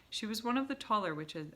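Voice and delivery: plain reading voice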